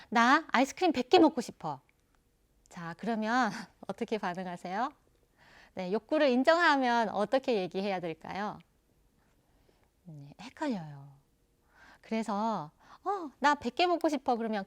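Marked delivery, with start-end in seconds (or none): 0.1-1.8 put-on childish demand. Child demanding hundred ice creams. 13.0-14.4 put-on childish demand.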